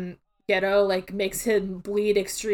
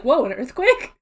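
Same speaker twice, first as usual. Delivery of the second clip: surprised gasp; 4 semitones higher, 4 dB louder than usual. Retold or imitated. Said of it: "Yuta's like".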